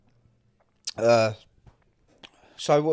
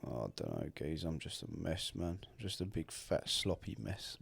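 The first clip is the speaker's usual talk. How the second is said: much lower, much softer, sorry for himself; mock self-pity; 8 semitones lower, 15 dB quieter than usual. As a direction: mope theatrically about being a mess. Self-pity after being out of breath.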